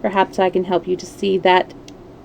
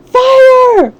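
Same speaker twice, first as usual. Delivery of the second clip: long full shout, much higher and louder; all together, hold the alarm cry; yelled; 8 dB louder than usual. A narrator's reading. Everyone shouts fire together, loud long shout. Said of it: "join in the cry of 'Fire!'".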